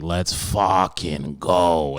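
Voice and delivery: slow, drawn-out mock Boston bro hype; lightly voiced rowdy Boston fan cheer; imitating a Boston fan. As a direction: stretch it out like a hyped Boston fan. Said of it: "on his fucking Snapchat like".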